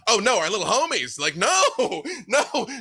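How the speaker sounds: high, laughing, fond mock distress; doting player voice. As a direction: coo over the sweet babies. Players fawning over the kids.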